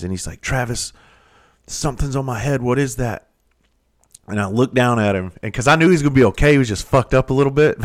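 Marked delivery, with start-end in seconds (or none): 0.4-3.3 higher, mildly frightened asking; a touch of a pinned man's worry; imitating scared question. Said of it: "with these scared eyes".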